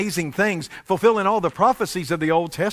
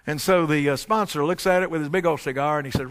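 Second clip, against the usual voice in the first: ordinary talk, nothing marked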